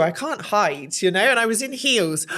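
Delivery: ordinary talk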